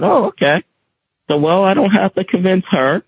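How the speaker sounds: high, lightly surprised okay; surprised relief. Retold or imitated. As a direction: pleasantly caught off guard.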